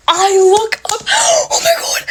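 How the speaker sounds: high, panicky wail with a gasp; frightened. Retold or imitated. Storyteller reliving the moment she looked up, panicked. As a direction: squeal it through a scared gasp.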